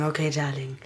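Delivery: murmured to herself, fond; soft aside. Acted. Repeats phrase, lower and softer.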